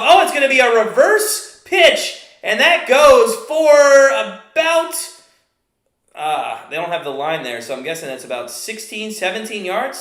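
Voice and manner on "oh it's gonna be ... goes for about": excited. Real, not acted. Big play breaks live.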